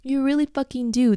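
usual speaking voice

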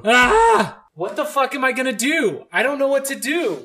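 high, frantic exasperation; exasperated panic. Real, not acted. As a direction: wail it like you're at your wit's end. Panicking over beeping alarm.

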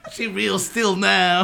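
Bill Cosby. Pretend. Podcaster narrating the fake unboxing video, drawn-out Cosby. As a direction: stretch it out in a mock impression. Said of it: "Cosby doing an unboxing video".

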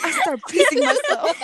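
high, loud, laughing through the words; repeating the punchline while laughing. Real, not acted. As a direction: crack up while saying it.

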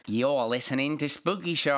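playful Australian show ident; put-on Australian accent. Australian friend greeting the show's listeners, put-on Australian accent. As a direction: announce it with a hammed-up Australian accent. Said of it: "[name] just really likes to do the accent".

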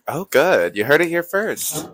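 ordinary talk, nothing marked

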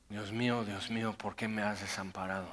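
quiet anguished cry. Retold or imitated. Preacher quotes Jesus on the cross, softly anguished. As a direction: lament it almost under the breath.

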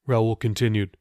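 ordinary delivery

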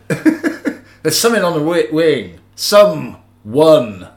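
slow, loud, halting impersonation with a big punched last word; amateur Shatner, drawn out and emphatic; William Shatner. Acted. Podcaster attempts a famous Twilight Zone line, bad Shatner impression. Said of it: "I can't do the Shatner voice".

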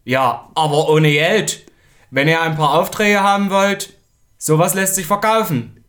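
loud, leering pitch for a shady deal; sleazy consultant. Acted. Consultant pushes porn onto the print shop, leering. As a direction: push the porn deal like a slick salesman.

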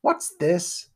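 put-on young master taken aback; puzzled little boy. A narrator's reading. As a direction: question it with suspicion. Rich boy puzzled by the collapse.

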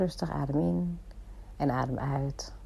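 softly guiding the breath; soft spa whisper. Shown as a demonstration. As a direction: hush down, soothing breath guide. Guides the listener's breathing.

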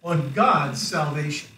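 slow call. Shown as a demonstration. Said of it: "repeat after me".

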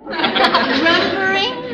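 slow, drawn-out baffled repeat; puzzled. Acted. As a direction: stretch the strange word out in confusion. Walter puzzled by odd word.

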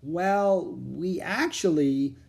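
hesitant, drawn-out start that stops; slow, uncertain false start; unsure. A narrator's reading. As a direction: stall slowly, not knowing what to say. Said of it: "Jack started. Then he stopped. He wasn't sure what to say".

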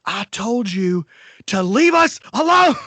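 put-on cranky old man finally snapping at a drunk stranger. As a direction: lose patience and bark the last words. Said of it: "And he's finally like".

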